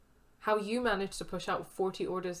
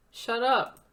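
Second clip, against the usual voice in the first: loud, insistent, falling finish; loud shut up again; 4 semitones higher, 8 dB louder than usual. Acted. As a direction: refuse to hear her self-pity. Friend repeats, insisting.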